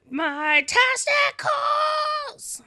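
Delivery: high stretched joke. Real, not acted. Joking title mix-up.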